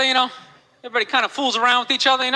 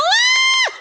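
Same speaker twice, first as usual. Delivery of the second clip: high piercing woman's scream; mock woman's scream, way up high; shrill scream; 3 dB louder than usual. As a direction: let out a sudden high yelp. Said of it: "She's going".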